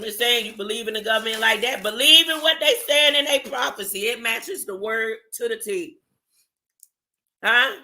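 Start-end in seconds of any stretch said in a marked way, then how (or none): none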